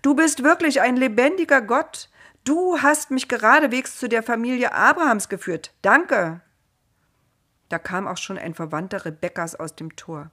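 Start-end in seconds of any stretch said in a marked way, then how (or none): 0.0-6.4 loud, high-pitched jubilant praise; raised, happy voice full of gratitude; joyful call. A narrator's reading. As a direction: praise God out loud, delighted. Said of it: "rief er fröhlich".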